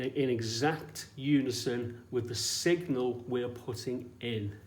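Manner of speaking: ordinary talk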